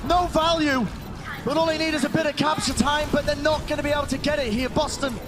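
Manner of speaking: high-pitched live esports call with stretched big moments; excited play-by-play. Real, not acted. Caster calls the final seconds of a round, excited. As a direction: sell the round's climax, drawing out key calls.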